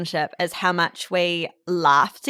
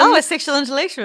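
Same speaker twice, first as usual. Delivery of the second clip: higher, quick teasing echo; playful echo; 7 semitones higher, faster than usual. Pretend. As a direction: tease the phrase back.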